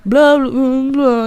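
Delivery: mock whining nonsense; lightly voiced tattling kid; whiny kid blabber. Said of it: "I was like".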